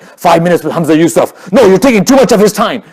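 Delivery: loud, high-pitched officious shouting; hammed-up handler hurrying people away, high and loud; pushy entourage handler. Celebrity scholar's entourage shooing fans, loud mock bossy shout. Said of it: "important people have entourages, they're always like".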